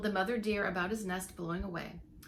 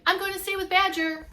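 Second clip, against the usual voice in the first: high, loud call while running; crying out; 10 semitones higher, 9 dB louder than usual. Acted. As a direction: call it out as you run on. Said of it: "he cried".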